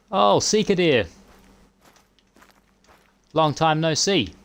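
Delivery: high, loud delighted cry; excited greeting. Real, not acted. Gamer spotting deer, delighted.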